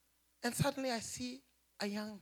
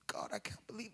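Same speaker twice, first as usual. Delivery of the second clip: hushed disbelief; 8 dB quieter than usual. Retold or imitated. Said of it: "I said".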